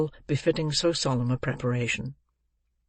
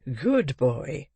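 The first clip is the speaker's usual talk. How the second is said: lightly voiced hearty old gentleman; a touch of stout approval. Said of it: "said Mister Brownlow stoutly".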